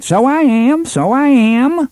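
very high, slow, hammed-up Hambry voice; wildly exaggerated Hambry accent. A narrator's reading. Cuthbert teases Roland in a wildly exaggerated Hambry accent. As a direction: overplay the Hambry accent for a laugh. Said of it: "in a wildly exaggerated Hambry accent".

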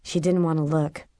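plain reading voice

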